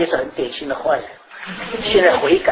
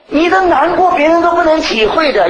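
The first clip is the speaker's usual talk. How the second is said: higher, louder fervent preaching line; preaching emphasis; 11 semitones higher, 5 dB louder than usual. Real, not acted. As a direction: drive the point home to the congregation. Preaching at full force. (提高声调激昂地讲道)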